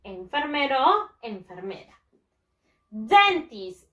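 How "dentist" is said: "Dentist" loud and drawn out, DEN-tist; model pronunciation. Shown as a demonstration. Teacher giving pupils a word to repeat, "Dentist" as loud DEN-tist. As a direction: model "Dentist" with a strong first syllable, falling after. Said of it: "Repeat after me".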